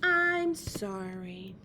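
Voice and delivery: slow, low, drawn-out sorry; contrite apology. Shown as a demonstration. Storyteller models apologizing to children, slow low contrite voice. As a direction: say sorry slowly, deep and contrite.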